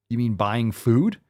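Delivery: ordinary talk, nothing marked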